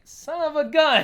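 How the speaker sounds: high, loud, drawn-out cry of frustration; mock outrage. Player who hit an illusion, loud mock outrage. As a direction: imitate a tricked player exploding in dismay. Retold outburst at being tricked.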